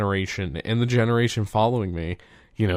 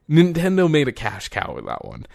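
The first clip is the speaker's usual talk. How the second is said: ordinary delivery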